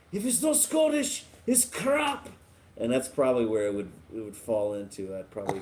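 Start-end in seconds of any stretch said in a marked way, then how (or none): 0.1-2.3 high, exaggerated mock Scottish accent. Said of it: "I would probably be like".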